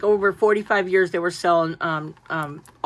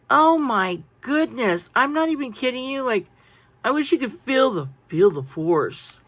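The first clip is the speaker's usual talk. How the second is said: normal talking voice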